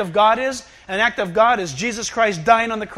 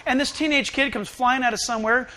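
ordinary talk

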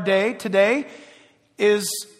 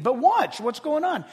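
ordinary talk, nothing marked

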